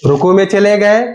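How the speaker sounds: normal talking voice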